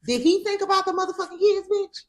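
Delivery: high, heated imitation of a cast member yelling; mock angry rant. Scene: mocking a cast member yelling about the kids, high and heated. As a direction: voice her outrage at the ex.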